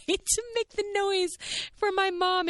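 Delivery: high-pitched, laughing through the words; amused squeal. Real, not acted. Recalling the noise she made for mom, high laughing squeal. Laughing at her own imitation attempt.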